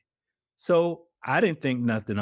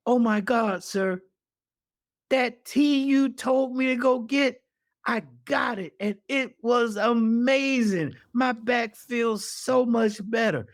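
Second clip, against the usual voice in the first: higher, gushing and amazed; delighted thanks; 11 semitones higher than usual. Retold or imitated. The widow tells Corey the tea healed her back, gushing and high. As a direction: bubble over with relief and thanks.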